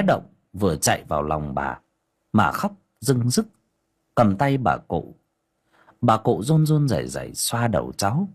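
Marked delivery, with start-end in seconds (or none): none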